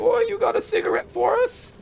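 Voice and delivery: put-on very high, squeaky Cockney child; Kuno. Kid hassling a passer-by for cigarettes, squeaky mock Cockney. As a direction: pester like a Cockney street urchin. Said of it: "They were basically Kuno".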